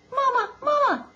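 put-on high, squeaky baby gosling call; Bright Bill. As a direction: peep for mother in a tiny high voice. Gosling calls to its mother.

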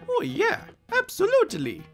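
very high-pitched, cheery little voice; cartoon hero voice. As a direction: squeak an eager agreement. Mario answers the professor.

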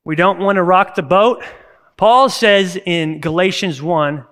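ordinary talk

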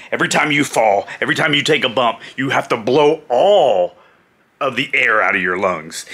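ordinary talk

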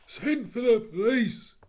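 a loud, cornered Cockney burglar. Acted. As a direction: plead like a cornered thief. Burglar pleads.

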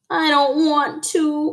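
put-on high, slow childish whine; whining. A narrator's reading. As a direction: drag it out in reluctant protest. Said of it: "whined Froggy".

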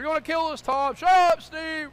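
high, loud quarrel; yelling argument. Retold or imitated. Two men in a sinking boat, yelling. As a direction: yell back and forth in panic. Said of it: "They were too busy yelling at one another".

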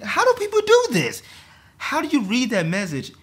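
very high, loud, incredulous cry; loud, high-pitched exasperation; exasperated outburst. Real, not acted. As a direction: cry out in disbelief, voice shooting up. Exasperated at the group text.

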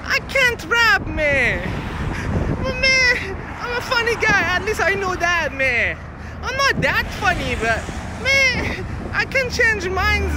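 very high-pitched put-on joker voice; comic "man" character. Self-declared funny guy riffing to viewers, high comic voice. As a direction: pitch it way up, drop on each man.

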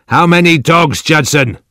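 high, loud angry demand; shouted. A narrator's reading. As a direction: shout the question at him. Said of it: "the old man shouted".

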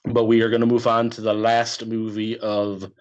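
normal talking voice

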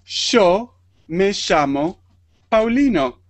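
"Yo me llamo" with y and ll said as sh, slowly; slow Rioplatense "Yo me llamo Paulino"; Argentine sh sound. Shown as a demonstration. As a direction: say "Yo" as sho and "llamo" as shamo. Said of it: "Entonces yo digo".

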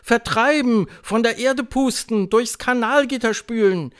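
high, loud, eager echo; cheering crowd of witches. A narrator's reading. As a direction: chant it back jubilantly. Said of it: "jubelte die Versammlung". (jubelnde Hexenmenge, hoch und laut)

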